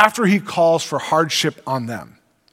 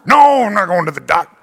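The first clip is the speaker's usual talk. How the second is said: louder, hammed-up stubborn grumble; grumbling refusal; 5 dB louder than usual. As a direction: loudly refuse like a sulky patient. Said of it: "You're grumbling".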